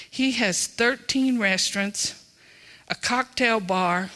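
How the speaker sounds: ordinary talk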